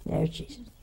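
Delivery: softly awed repeat; soft, reverent wonder; hushed awe. Retold or imitated. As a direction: drop to a hushed voice. Awed repeat of seeing Jesus.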